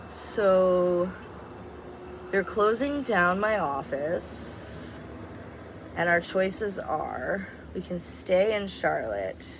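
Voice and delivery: imitating careful delivery of bad news, drawn out; slow, deliberate announcement. Husband telling wife his office is closing, slow and deliberate. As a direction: spell out the choices very slowly.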